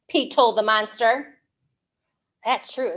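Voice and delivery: plain reading voice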